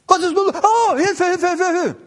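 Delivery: mock frantic cries of help; mock panic. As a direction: play a believer calling for help in a hammed-up panic. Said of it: "Wir sind immer in Panik".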